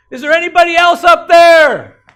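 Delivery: very high, full-volume shout; comic desperate bellow to the sky; desperate yell. Acted. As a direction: scream up for someone else to help. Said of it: "Then he yells".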